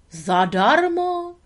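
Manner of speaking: slow, high delighted drawl of the word; pleased echo. Acted. The pleased beaver repeats Lady's word. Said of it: "opakoval bobr spokojeně".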